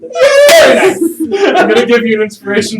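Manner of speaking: loud, high, laughing shout; excited shout. Real, not acted. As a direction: burst out with glee. Excited outburst.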